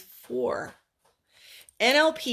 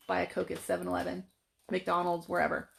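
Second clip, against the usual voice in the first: rattled off fast and softer, like hidden fine print; fast soft ad whisper; 3 semitones lower, 7 dB quieter, faster than usual. Retold or imitated. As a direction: slip the sales pitch in quickly and softly. Hypnotic ad message voiced.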